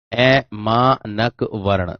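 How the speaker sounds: ऐमानक वर्ण very slow, broken into syllables. Shown as a demonstration. Teacher writing the term for the class, ऐमानक वर्ण slowly in syllables. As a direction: space out each part of ऐमानक वर्ण.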